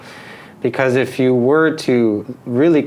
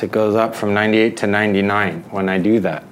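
ordinary talk, nothing marked

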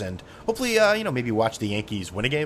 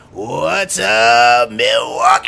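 loud stage shout to a stadium; rock star shout; 11 dB louder, much slower than usual. Retold or imitated. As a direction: yell it like a rock frontman greeting the city. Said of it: "a band goes to a city and be like".

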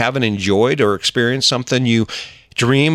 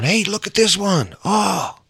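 excited find; 9 semitones higher than usual. Retold or imitated. Quoting wife finding stones.

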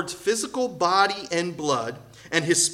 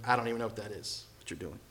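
softer, lower bewildered Paul; baffled mutter; 7 semitones lower, 13 dB quieter, faster than usual. Retold or imitated. Preacher voices Paul baffled by the meal, soft and lower. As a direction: murmur it in disbelief. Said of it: "He was like".

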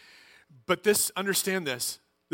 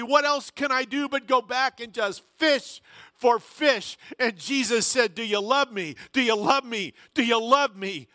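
louder, higher fervent preaching push; impassioned preaching; 6 semitones higher, 5 dB louder than usual. Acted. Pastor urging the congregation, preaching at full force. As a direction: preach it out with rising urgency.

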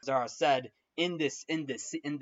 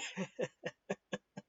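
soft breathy chuckle; laughing; 3 semitones higher, 10 dB quieter than usual. Real, not acted. Amused by the disaster of a rehearsal, quiet laugh.

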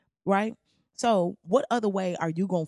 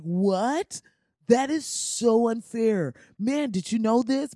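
higher, louder, slow mock shock; mock shocked outrage; 6 semitones higher, 5 dB louder, slower than usual. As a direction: play up a stunned, offended whiner.

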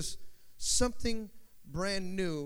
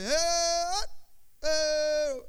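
higher, louder barked calls; guards calling out; 8 semitones higher, 5 dB louder, much slower than usual. Retold or imitated. Palace guards calling orders, mock shouts. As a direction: call out like a guard on duty. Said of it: "you'll hear 'em talking".

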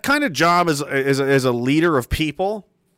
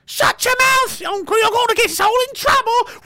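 frantic shout. Pretend. Clan relative yelling at uncle, high panicked Scottish shout. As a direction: scream at the uncle to shut up.

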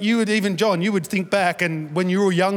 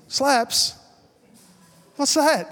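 pitched up, puzzled echo of the slang; mock bewildered; 6 semitones higher, much slower than usual. Pastor baffled by youth slang, high puzzled question. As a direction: play the confused older man. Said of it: "I said".

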